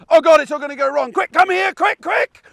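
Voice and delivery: a frantic owner shrieking for the dog, hammed up; panicked call. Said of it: "the panic in their voice".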